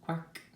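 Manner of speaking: high, short quack; quick storybook quack; duck quack. A narrator's reading. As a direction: imitate a duck with one high note. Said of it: "said a duck".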